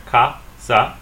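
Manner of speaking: "casa" as two separate beats, ca, sa; split into syllables. Shown as a demonstration. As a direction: break "casa" in two with a gap. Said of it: "We split it right here".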